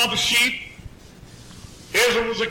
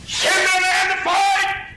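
Goliath's challenge; 11 semitones higher, slower than usual. Acted. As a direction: call it across the valley.

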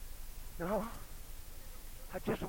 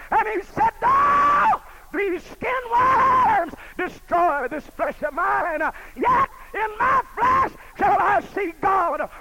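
loud, high, impassioned preaching; preaching at full force; 10 semitones higher, 8 dB louder than usual. Acted. Preacher to his congregation, retelling Job, full-force preaching. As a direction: thunder it out to the congregation. Preaching climax, Job's creed.

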